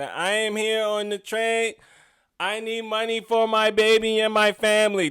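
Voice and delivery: imitating a woman asking for money on the train, lightly; train beggar voice. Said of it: "That's how she talks".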